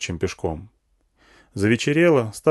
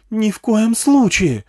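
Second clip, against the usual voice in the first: slow, very high, furious woman's refusal; loud, drawn-out angry shriek; angry yell; 12 semitones higher, 6 dB louder, slower than usual. A narrator's reading. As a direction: yell a flat-out no at her kid. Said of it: "заорала зло тётка".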